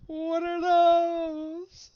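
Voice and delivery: high, slow, alarmed; shocked cry. Real, not acted. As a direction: cry out in disbelief. Sudden panic at strange cards.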